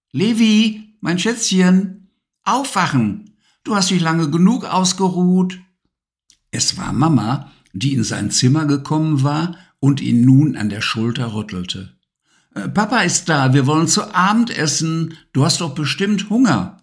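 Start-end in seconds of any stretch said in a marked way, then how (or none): 0.1-5.6 put-on a touch higher, gentle mum waking her child; lightly voiced mother. A narrator's reading. Mother wakes Levi for dinner, lightly voiced and gentle. 12.5-16.8 put-on a touch higher, gentle mum waking her child; lightly voiced mother. A narrator's reading. Mother wakes Levi for dinner, lightly voiced and gentle.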